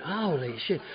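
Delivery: hushed stunned holy shit; awed hush. Retold or imitated. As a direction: barely breathe it out in awe.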